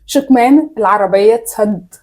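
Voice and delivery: slow, carefully mouthed; slowly. Shown as a demonstration. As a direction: draw each word out for lip reading. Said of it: "بالراحة".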